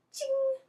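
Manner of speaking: high ringing "ching"; imitated lever click. Student mimics pushing the lab lever, imitated clink. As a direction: make a bright lever clink noise.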